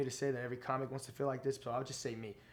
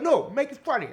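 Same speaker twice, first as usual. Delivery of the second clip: much higher, louder, different voice; Tracy Morgan impression; 13 semitones higher, 12 dB louder than usual. Comic imitating the star thanking the host, high and loud. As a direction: do a bad celebrity voice on purpose. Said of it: "Tracy Morgan impression".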